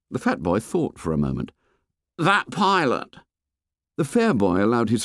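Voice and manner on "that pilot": put-on fat boy voice. A narrator's reading. Fat boy complains to the fair boy, high and whiny. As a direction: complain like a chubby schoolboy.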